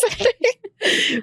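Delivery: slow word dissolving into laughter; laughing. Real, not acted. Laughing at her own story.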